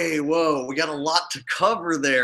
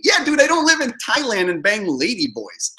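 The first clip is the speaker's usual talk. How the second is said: normal talking voice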